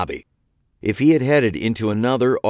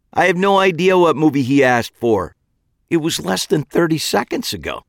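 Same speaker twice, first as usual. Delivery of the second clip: held-back exasperation; 7 semitones higher, 4 dB louder than usual. A narrator's reading. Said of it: "trying to keep an edge of exasperation out".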